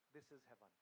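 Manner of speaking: quiet monk teaching the samurai; calm quiet monk. Acted. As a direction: say it gently, with certainty. Monk's calm final reply.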